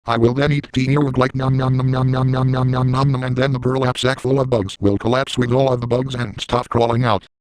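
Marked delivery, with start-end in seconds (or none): 1.4-3.2 mock eating noises. Eating sound effect.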